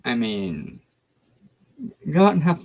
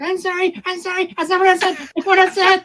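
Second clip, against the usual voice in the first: mock husband apology; 4 dB louder than usual. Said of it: "be like".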